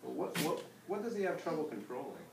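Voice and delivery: ordinary delivery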